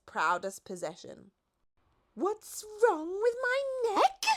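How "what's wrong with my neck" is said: high, slow indignant snap; snapping. A narrator's reading. The giraffe snaps at the Duke about her neck. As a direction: bite back, offended. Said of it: "snapped the giraffe".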